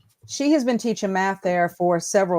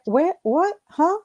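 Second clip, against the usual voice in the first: high-pitched, rising disbelief; acted incredulous questions; mock bafflement; 8 semitones higher, slower than usual. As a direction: play dumbfounded, each word a rising question. Acts out bafflement at parents' demands.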